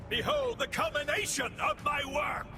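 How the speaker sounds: high, ringing villain's boast; grandiose proclamation. Acted. Villain unveiling his work to opponents, grandiose. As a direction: proclaim it like a triumphant megalomaniac.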